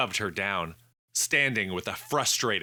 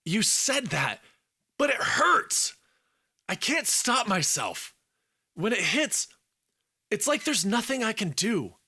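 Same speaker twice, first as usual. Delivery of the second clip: put-on much higher, strained young man's voice; a pained plea, pitched up high; higher voiced Alex, hurting and desperate; Alex in distress; 13 semitones higher than usual. A narrator's reading. Alex struggling with the wrath.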